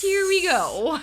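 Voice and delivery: high, drawn-out cue; host's "here we go"; acted going-live launch. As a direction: launch the show on a lifted note. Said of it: "go live and go".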